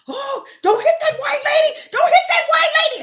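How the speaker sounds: high, loud pleading cry; pleading elderly women. Retold or imitated. Elderly women begging the speaker not to hit back, high pleading cry. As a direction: beg her not to hit the lady.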